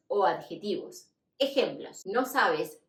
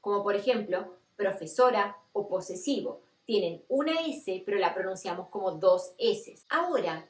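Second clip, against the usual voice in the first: ordinary delivery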